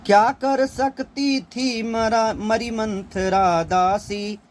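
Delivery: Kaikeyi's lament recited slowly as verse; poem recitation. Acted. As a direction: lift the line and stretch it like recited poetry.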